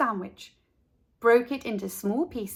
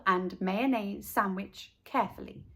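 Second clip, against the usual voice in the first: usual speaking voice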